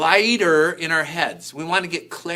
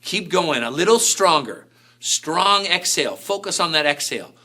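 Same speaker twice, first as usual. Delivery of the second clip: encouraging coach; 2 semitones higher than usual. Real, not acted. Coaching during fire breathing.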